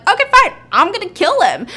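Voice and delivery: loud, high, fast huff; exasperated anger. Retold or imitated. Bad cop giving in to do it himself, loud exasperation. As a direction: give in with an angry outburst.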